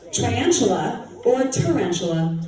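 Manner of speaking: "triantula" said as try-antula, then "tarantula" said the standard way; two pronunciations. Shown as a demonstration. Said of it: "Is it a".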